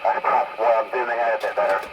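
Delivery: usual speaking voice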